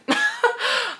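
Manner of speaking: sudden squeaky laugh; real high-pitched laugh; high burst of laughter. Real, not acted. Cracks up at own outburst.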